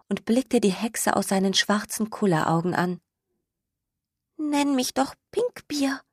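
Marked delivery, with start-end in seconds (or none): 4.4-6.0 high, breathy little skunk whisper; whispered softly. Acted. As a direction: whisper it shyly, a small hopeful request. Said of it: "sagte es leise".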